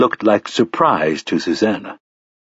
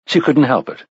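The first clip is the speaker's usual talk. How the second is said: ordinary delivery, nothing marked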